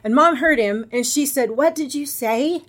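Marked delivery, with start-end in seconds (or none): 1.5-2.6 slow, higher-pitched imitation of disbelief; imitating an aghast mom; retold shocked mother. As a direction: draw out an appalled question, higher. Retold mother overhears the threat.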